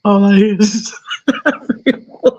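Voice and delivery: high, loud words between laughs; laughing. Real, not acted. Trying to keep reading, still laughing high. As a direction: fight to talk through laughter.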